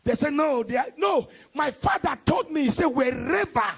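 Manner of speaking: loud call and response sermon; fiery preaching. Acted. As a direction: hammer each line to the congregation. Preaching at full force.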